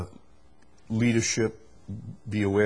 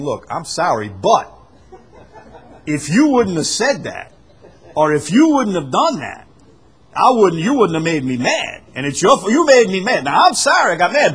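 sorry that swells into a louder, higher blaming rant; fake angry apology; 6 semitones higher, 9 dB louder than usual. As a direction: start sorry, then heat up into accusing them. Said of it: "that's not an apology".

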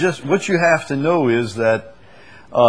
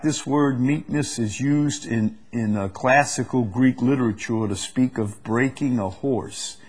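ordinary talk

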